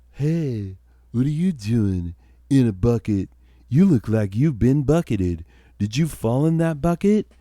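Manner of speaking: put-on high-pitched drunken surfer-dude frat boy. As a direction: play a frat boy fourteen beers in. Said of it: "and he was like".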